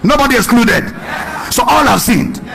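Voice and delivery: emphatic shout. Real, not acted. Preacher driving home point.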